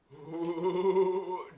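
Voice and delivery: long mock stoner chuckle; Seth Rogen weed laugh. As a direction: draw out an imitated stoner laugh. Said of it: "like, weed voice for Donkey Kong".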